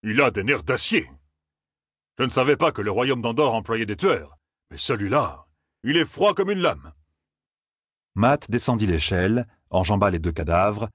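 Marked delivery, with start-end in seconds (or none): 0.0-6.9 higher, awed captain voice; exclaiming admiration. A narrator's reading. Captain to the passengers, awed. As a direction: marvel at him aloud.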